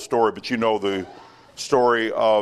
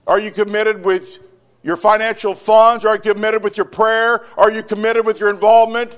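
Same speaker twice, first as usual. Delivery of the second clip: loud, high preaching; sermon at full force; fiery preaching; 11 semitones higher, 9 dB louder than usual. Acted. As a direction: press the congregation hard.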